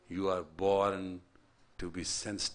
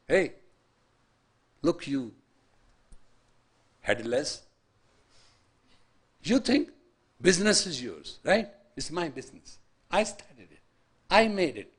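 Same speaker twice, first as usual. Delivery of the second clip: a touch of a boastful owner, rising higher and louder; lightly voiced smug businessman; arrogant owner; 7 semitones higher, 7 dB louder than usual. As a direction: sneer like a proud boss claiming it all.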